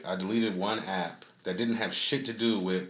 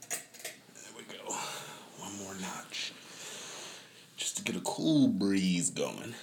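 hushed aside; 3 dB quieter, slower than usual. Real, not acted. Adjusting fan, hushed asides.

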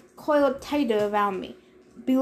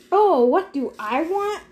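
higher, sarcastic echo; mocking echo; 6 semitones higher, 3 dB louder than usual. A narrator's reading. God echoes trapped hero's question, mocking. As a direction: throw the question back in mock surprise.

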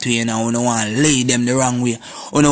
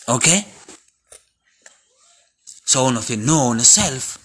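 ordinary talk, nothing marked

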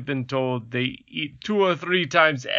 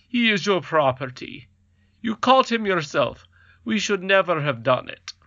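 pompous king, pitched up; goblin king; 6 semitones higher than usual. Acted. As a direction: play the king a little higher. King voiced by reader.

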